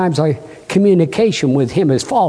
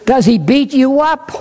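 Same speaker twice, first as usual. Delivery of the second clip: a higher, testy judge pressing for an answer; impatient judge; 8 semitones higher than usual. Retold or imitated. Joke judge impatiently questioning the woman, testy and higher. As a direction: push the question, running out of patience. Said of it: "the judge said impatiently".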